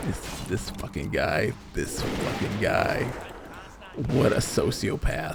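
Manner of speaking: muttering about the rude customer, low and seething; low seething grumble. Retold or imitated. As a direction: mutter your fury to yourself. Retold seething inner thought.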